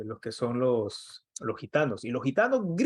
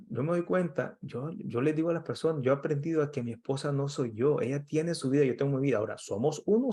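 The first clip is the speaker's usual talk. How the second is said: normal talking voice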